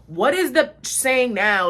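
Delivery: loud, slow, fed-up question; acted exasperation. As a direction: demand it loudly, stretching the words in exasperation. Retelling exasperated reply to more gossip.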